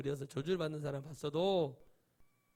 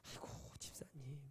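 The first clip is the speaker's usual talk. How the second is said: whisper; 2 semitones lower, 15 dB quieter than usual. Retold or imitated. Gentle persuasion beside a friend.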